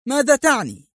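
the anger boils over into a high yell; insulted man repeating his question in a high furious yell; shrieking angry shout. A narrator's reading. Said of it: "صائحا في غضب".

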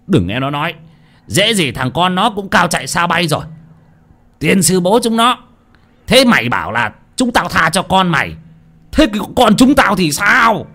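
rising, contemptuous retort; bitter scorn. Acted. Old Thìn rejecting Hoạch's plea, rising and scornful. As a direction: spit back scornfully.

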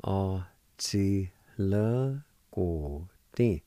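A chi le guo ding spoken very slowly, syllable by syllable; slow demo. Shown as a demonstration. Teacher to students modelling the word, very slow. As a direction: stretch out A chi le guo ding so every sound is clear. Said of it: "It's pronounced like this".

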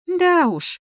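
high, scoffing little retort; sceptical. A narrator's reading. Heroine answers the familiar's starvation complaint, sceptical. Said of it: "скептически усмехнулась".

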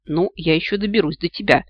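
plain reading voice

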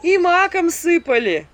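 high, loud, slow complaint; loud village keening; wailing lament. A narrator's reading. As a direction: keep wailing the remedies. Woman continues the lament.

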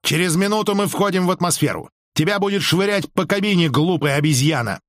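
резко, высоким голосом; отчитай повышенным тоном, без крика; резкое предупреждение. A narrator's reading. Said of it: "голос его утратил резкость". (резко)

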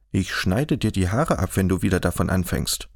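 ordinary delivery

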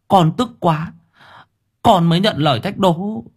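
put-on a boy with head bowed making excuses; young boy explaining. A narrator's reading.

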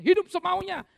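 sudden high loud cry; shouted jab. Acted. Preacher crying out at the congregation, sudden high shout. Preacher mocking careless living.

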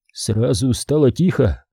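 ordinary delivery, nothing marked

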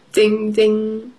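ordinary delivery, nothing marked

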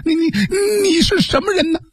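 high-pitched frightened stammer; terrified, trembling. A narrator's reading. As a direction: play a frightened woman stammering at a stranger. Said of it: "吓得浑身颤抖". (吓得颤抖，尖声结巴地问)

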